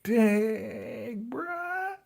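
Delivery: high, stretched mock shock; stunned reaction. Vlogger reacting to Brother Elijah's threat, high stunned shock. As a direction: act floored by what you just saw. I'm like.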